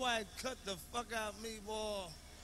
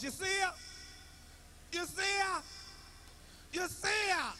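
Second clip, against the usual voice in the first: calling out; 9 semitones higher than usual. Retold or imitated. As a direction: call out across the yard for her. Wife calling out from the porch.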